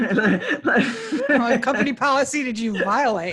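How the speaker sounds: slow scolding question through laughter; amused, drawn-out mock accusation; laughing mock reprimand. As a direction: pretend to scold while cracking up. Joking mock correction of employee.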